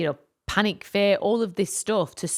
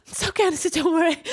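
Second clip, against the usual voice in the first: forced reassurance; 10 semitones higher, 3 dB louder, faster than usual. Retold or imitated. Brushing off helper while hurting.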